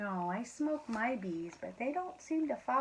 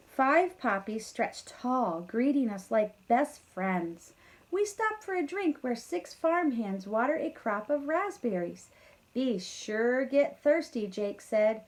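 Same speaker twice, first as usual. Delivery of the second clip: lively storybook read-aloud; reading to children; 2 semitones higher, 6 dB louder than usual. A narrator's reading. Teacher reading a farm story to kindergarteners, lively. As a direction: read it brightly to a class of kids. Reads picture book aloud.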